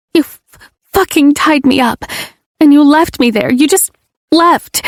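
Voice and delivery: high, rising hurt accusation; anguished rant. Acted. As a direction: build to the word left. Heroine rants at Ledger.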